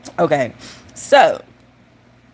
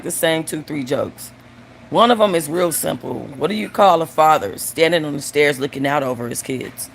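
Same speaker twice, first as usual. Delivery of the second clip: normal talking voice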